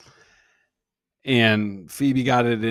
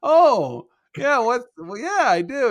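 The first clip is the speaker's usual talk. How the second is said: much higher, louder fake surprise; mock surprise; 11 semitones higher, 6 dB louder than usual. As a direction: play dumb in a high, hammed-up voice. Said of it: "I was like".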